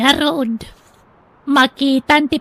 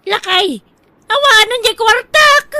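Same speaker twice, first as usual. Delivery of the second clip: high, loud alarm over lost money; panicked cry; 9 semitones higher, 6 dB louder than usual. Acted. Wife tells husband the money is gone, panicked. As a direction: blurt it out in a panic.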